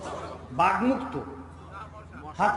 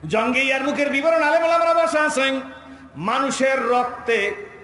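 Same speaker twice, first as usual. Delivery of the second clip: impassioned preaching; 5 semitones higher, 5 dB louder than usual. A narrator's reading. Preacher describing Yarmuk before seated scholars, impassioned preaching. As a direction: swell up and stretch the final phrase. Preaches battle story at full force.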